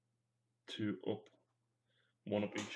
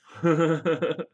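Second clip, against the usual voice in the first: loud laugh; 6 semitones higher, 8 dB louder than usual. Real, not acted. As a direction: burst of high laughter. Laughing at own joke.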